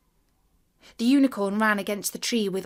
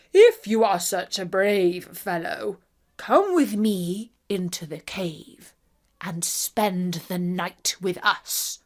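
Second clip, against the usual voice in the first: giant's voice; 2 semitones lower, slower than usual. A narrator's reading. Giant's reply.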